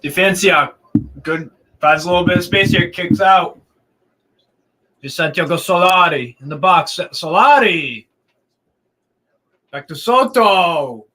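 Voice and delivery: lively live match call; play-by-play commentary. Acted. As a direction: call the action as it happens.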